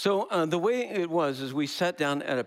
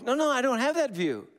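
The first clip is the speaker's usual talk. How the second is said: much higher, louder indignant denial; mock protest; 13 semitones higher, 4 dB louder than usual. B refusing the merged view, high and indignant. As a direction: refuse the label, pitched way up. Acted quarrel voices.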